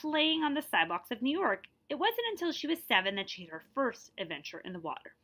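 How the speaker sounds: clear, lively read-aloud narration; story narration. A narrator's reading. Teacher reading a picture book to her class, lively narration.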